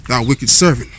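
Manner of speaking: usual speaking voice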